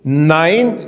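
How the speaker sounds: usual speaking voice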